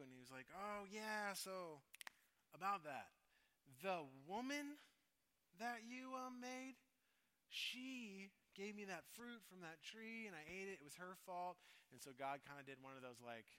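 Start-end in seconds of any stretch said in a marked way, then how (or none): 0.5-11.6 higher, awkward, slow, shifting the blame; stall, then pin it on her; sheepish blame-shifting. Retold or imitated. Adam answering God, awkwardly blaming the woman.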